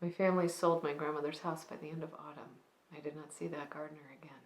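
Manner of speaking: soft, hushed dramatic monologue with long pauses; monologue de souvenir endeuillé. Acted. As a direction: confide a grandmother's story quietly, letting sentence endings sink away. Performs a reflective, grieving monologue.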